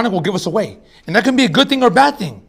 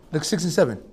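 normal talking voice

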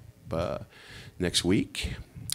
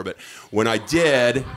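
ordinary delivery

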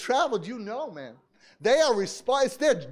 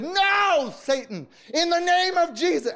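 high, loud rebuke of Satan; rebuking shout; 7 semitones higher, 3 dB louder than usual. Retold or imitated. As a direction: cast the devil out at full cry. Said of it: "I was screaming to the top of my lungs".

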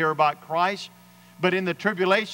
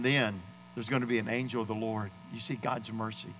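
normal talking voice

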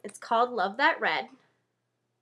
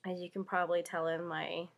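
usual speaking voice

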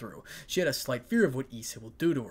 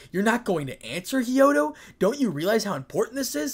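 raised, urgent serious questioning; higher and louder, in earnest; 10 semitones higher, 8 dB louder than usual. A narrator's reading. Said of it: "Sona spoke in a serious tone".

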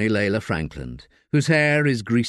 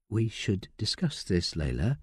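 quiet caution; 2 semitones lower, 8 dB quieter than usual. Acted. Solicitor cautioning Layla softly. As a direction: warn her client quietly.

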